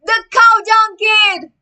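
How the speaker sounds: high, loud, slow jeer; jeering shout. Acted. Another kid jeers at Greg, high loud shout. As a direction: call him out mockingly. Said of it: "said another voice".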